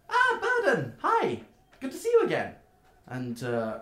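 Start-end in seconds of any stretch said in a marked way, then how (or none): 0.1-2.6 very high, loud gnome voice; gnome's greeting. Acted. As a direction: squeal a delighted hello to an old friend. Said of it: "and she goes".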